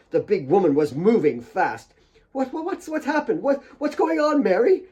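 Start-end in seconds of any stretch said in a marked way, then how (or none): 2.3-4.8 stammering, high and confused; high flustered stammer. A narrator's reading. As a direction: stumble over the words, lost. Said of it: "Kate was confused".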